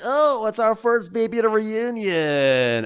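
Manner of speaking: high, slow, cooing host voice; mock host Andy. Imitating Andy cooing over the crying baby, high and slow. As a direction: coo it slowly like a gushing host. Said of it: "Andy's like".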